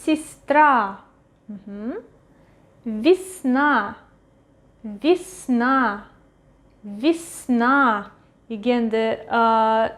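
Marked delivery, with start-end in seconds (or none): 0.0-1.0 Сестра with е as i, stress on the final a; reduced unstressed Ye. Shown as a demonstration. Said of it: "pronounced as И". 2.8-8.1 Весна with е as i, stress on the final a; reduced unstressed Ye. Shown as a demonstration. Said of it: "stress goes to A".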